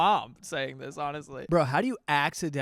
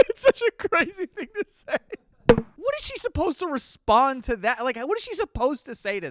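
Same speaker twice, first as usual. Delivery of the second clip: incredulous laugh; 9 semitones higher, 4 dB louder than usual. Real, not acted. Laughing disbelief at text.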